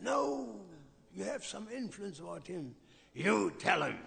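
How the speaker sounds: loud, high, domineering shout; imitating a threatening uncle yelling at the monk; bullying demand. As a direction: bark the order like a tyrant demon king. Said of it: "He sounded like Hiranyakashipu almost".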